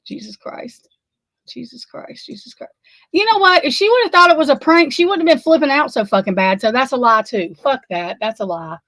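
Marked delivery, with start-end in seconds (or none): 0.0-2.7 hushed, stunned disbelief; barely audible, shaken; stunned murmur. Real, not acted. Stunned reaction to news. 3.1-6.6 heated and worked up; agitated outburst. Real, not acted. Upset rant about a lie.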